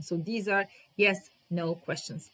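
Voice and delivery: normal talking voice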